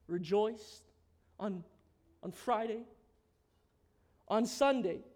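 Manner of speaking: soft, drawn-back sermon voice; hushed preaching. Acted. Preacher quietly recounting Jesus' death to the congregation: hushed. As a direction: drop low and intimate.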